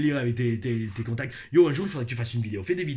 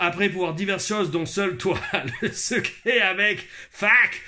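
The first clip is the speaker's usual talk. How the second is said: put-on Quebec accent; 2 semitones higher than usual. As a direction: ham up the Quebec French, building to the curse. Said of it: "notez mon accent québécois". (accent québécois exagéré)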